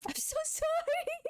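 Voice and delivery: high-pitched silly apology; squeaky apology. Pretend. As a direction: squeak the "sorry" up high like a cartoon.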